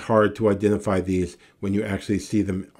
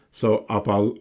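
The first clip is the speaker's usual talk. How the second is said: ordinary talk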